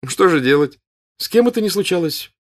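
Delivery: high, loud, brazenly offhand; cheeky, bold tone. A narrator's reading. Son to father, brazenly shrugging off the loss. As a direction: act bold to hide shame. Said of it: "развязным, смелым тоном".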